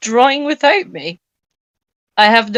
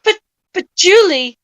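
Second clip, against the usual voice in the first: calling out; 9 semitones higher, 4 dB louder than usual. Acted. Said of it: "I called".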